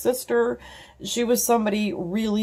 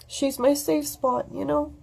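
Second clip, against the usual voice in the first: teary sigh; 7 semitones higher, 5 dB quieter than usual. Real, not acted. Emotional about friend.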